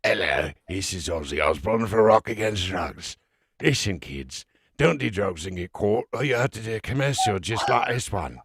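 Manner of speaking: put-on drunk, slurred British rocker, thicker and mushier. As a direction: slur the anti-drug PSA like a sozzled British rocker. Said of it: "had to put myself in drunk mode to do the Aussie commercial".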